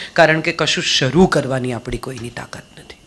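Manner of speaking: breathy whispered aside, dropping to a hush. Real, not acted. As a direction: whisper it as a quiet secret to the audience. Hushed point in a talk. (ધીમા અવાજે ફફડાટ)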